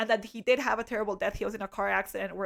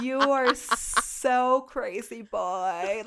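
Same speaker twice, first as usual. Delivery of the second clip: sarcastic tease; much slower than usual. Retold or imitated. Sarcastic reply to date.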